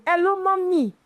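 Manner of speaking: high, sing-out greeting to an elder; lifted, deferential hello; respectful greeting. Acted. Demonstrates greeting clients' mothers.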